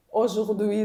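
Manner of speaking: normal talking voice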